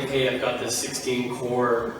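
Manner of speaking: normal talking voice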